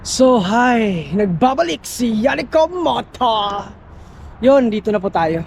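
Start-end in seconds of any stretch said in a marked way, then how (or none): 1.1-3.7 announcer intro. Acted. Vlogger announces his channel return.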